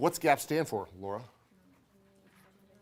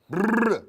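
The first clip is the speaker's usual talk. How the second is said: high, loud rolled-lip noise; loud trilled brrr; 10 semitones higher, 12 dB louder than usual. Real, not acted. Hyper teacher resetting after talking fast, loud trilled brrr.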